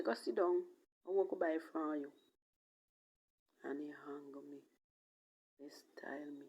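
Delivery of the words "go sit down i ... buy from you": mock curt dismissal, louder and higher; father's brush-off. Quoting her father dismissing her, a mildly acted loud retort. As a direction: snap it back as the scornful father. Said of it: "He say".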